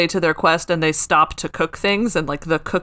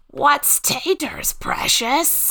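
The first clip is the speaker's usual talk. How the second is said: higher, slow strained Gollum; 8 semitones higher, much slower than usual. Pretend. Second try at Gollum for the cohosts, high and slow. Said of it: "That was pretty good".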